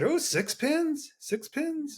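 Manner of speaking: high, soft, slow drawn-out "Ooh, six pins"; breathy-free hushed high mimic of Mo; softly mimicking Mo's high voice; imitating Mo. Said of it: "He said".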